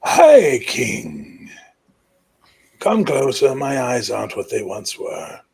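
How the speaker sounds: put-on high, loud old king's voice. Old king summoning the young high king closer. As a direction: croak it out like an ancient ruler. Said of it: "And the old man goes".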